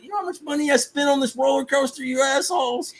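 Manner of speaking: high, indignant rant. Retold or imitated. Park owner scolding guests who walk away, high indignant. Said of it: "I was just like".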